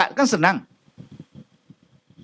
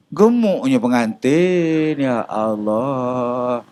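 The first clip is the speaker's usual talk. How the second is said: slow, drawn-out gossiping gasp; mock gossip; 9 semitones lower, much slower than usual. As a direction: sneer at the groom, stretching it. Gossiper mocking the fat groom. (mengumpat dengan nada panjang mengejek)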